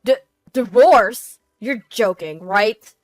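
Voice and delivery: loud, stammering alarm; husband blindsided, tripping on the word; shocked disbelief. Acted. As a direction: blurt it out in stunned disbelief. Husband shocked by announced divorce.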